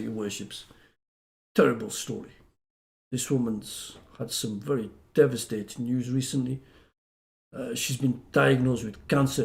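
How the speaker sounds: low, soft, grave address; solemn court plea. Retold or imitated. Advocate telling magistrates a client's hard story, solemn. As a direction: plead a sad case quietly to the bench.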